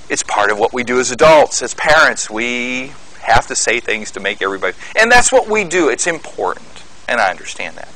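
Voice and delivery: ordinary talk, nothing marked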